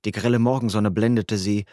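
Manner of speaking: ordinary delivery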